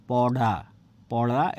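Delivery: "পড়া" low, with a retroflex flap on ড়; tongue-curled ড়. Shown as a demonstration. Teacher attempting the sound for students, retroflex "পড়া". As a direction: flip the tongue back for ড় in each "পড়া". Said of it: "জিহ্বাটা উল্টিয়ে দিয়ে বলার চেষ্টা করেছি".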